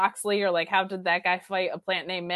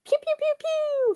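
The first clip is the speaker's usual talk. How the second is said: high-pitched pew pew sound effect; bullet ricochet. Retold or imitated. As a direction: mimic a laser gun ricochet. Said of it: "Not even a good Ricochet sound".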